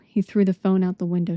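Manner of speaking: plain reading voice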